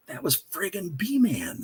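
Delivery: slow, hushed aside; whispered mutter. Retold or imitated. Rock star hanging up the phone, whispered and slow.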